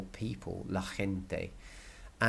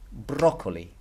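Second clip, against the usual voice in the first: "broccoli" as BRO-co-li, falling away; "broccoli" stressed at the start; stress on first syllable; 3 dB louder than usual. Shown as a demonstration. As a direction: put the emphasis on BRO in "broccoli". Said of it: "that's where the accent is".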